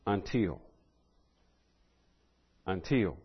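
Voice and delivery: soft, falling "Until." left hanging; quiet repeated "Until."; dramatic pause. Shown as a demonstration. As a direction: drop the voice on "Until." and let it sit.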